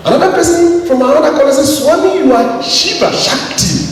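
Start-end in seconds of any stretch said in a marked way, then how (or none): 1.7-3.9 eager calling out. Retold or imitated. Another student calling out.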